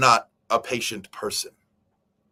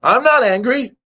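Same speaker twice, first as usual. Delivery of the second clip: loud, high-pitched angry protest; angry denial; 10 semitones higher, 9 dB louder than usual. Acted. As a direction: deny being angry while clearly furious. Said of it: "you sound kinda angry to me".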